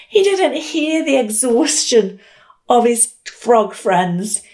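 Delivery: ordinary talk, nothing marked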